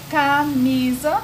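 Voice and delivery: "camisa" with a long, high, loud "mi"; stress on MI. Shown as a demonstration. Teacher demonstrating the strongest syllable, loud drawn-out stress on "mi" in "camisa". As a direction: draw out "camisa", punching the "mi". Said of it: "o mi é a sílaba mais forte".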